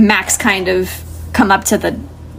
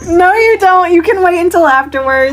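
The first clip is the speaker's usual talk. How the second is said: laughing protest; 8 semitones higher than usual. Real, not acted. Game master stopping a player texting the group, laughing. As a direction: object through a burst of laughter.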